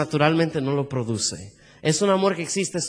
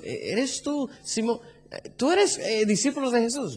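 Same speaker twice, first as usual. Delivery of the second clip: higher-pitched, hesitant questioning; acted accuser, pitched up and stammering; bystander questioning Peter; 5 semitones higher, 4 dB quieter than usual. Bystander asks if he is Jesus' disciple, high-pitched probing. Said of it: "tres veces le hicieron eso".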